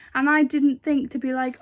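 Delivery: ordinary talk, nothing marked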